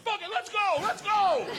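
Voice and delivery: high, hollered bravado; hyped-up. Retold or imitated. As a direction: psych yourself up like you're a beer in. Retold drunken hype after beer.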